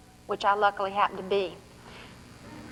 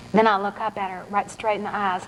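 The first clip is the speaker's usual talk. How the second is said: plain reading voice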